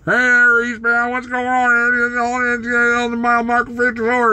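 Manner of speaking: high-pitched, slurred CB trucker chatter; mock trucker radio call, marbles in the mouth. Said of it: "those guys on the C B radio".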